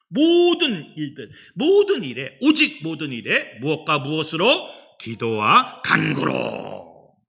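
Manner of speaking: loud, slow sermon emphasis; forceful preaching. Real, not acted. Preacher exhorting the congregation, loud and slow. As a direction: drive the words home.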